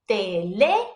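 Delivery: "teléfono" with LÉ hammered and held; hammered accent. Shown as a demonstration. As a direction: hammer the LÉ in teléfono, then pause. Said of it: "like, hammering that letter".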